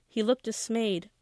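ordinary delivery, nothing marked

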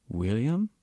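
a shaky, unsure rise on William; the old Sikh quavers the name back to Lowboy, unsure; quavering. Acted. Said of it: "the Sikh said quaveringly".

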